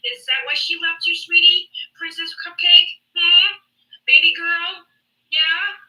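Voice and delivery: high, loud, sugary-mocking pet names; condescending taunt. Real, not acted. Woman baiting a man over his wife leaving, condescending taunt. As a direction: needle them with belittling sweet talk.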